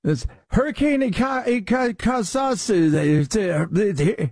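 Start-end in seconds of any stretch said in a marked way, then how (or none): 0.5-2.9 high, stammered stabs at "Ika-, Kasasu"; imitated fumbling of "Kasasu", pitched up; mock mispronunciation. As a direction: trip over "Ika-, Kasasu" like someone who can't say it. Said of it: "completely different than the first time".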